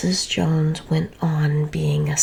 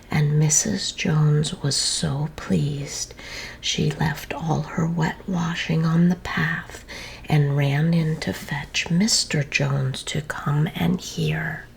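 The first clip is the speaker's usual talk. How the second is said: usual speaking voice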